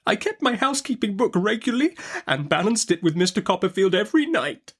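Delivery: high, tearful outburst; tearful cry. Acted. Mother to Betsy, tearful cry. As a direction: cry it out through tears. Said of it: "cried my mother in another".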